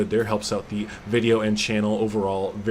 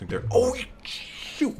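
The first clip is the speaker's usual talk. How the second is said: normal talking voice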